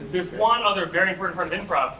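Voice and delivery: ordinary talk, nothing marked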